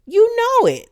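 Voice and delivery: high, loud, fed-up jab; real indignant squawk at the mother; exasperated outburst. Real, not acted. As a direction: call her out, voice jumping up. Ranting at the show's mother.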